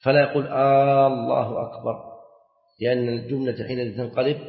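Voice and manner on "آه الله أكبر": "آه الله أكبر" with a long questioning-form "aa" at the start; drawn-out first hamza. Shown as a demonstration. Teacher showing students a wrong takbir, opening hamza stretched long. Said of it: "أن لا يمد الهمزة".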